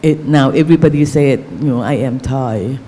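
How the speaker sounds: ordinary talk, nothing marked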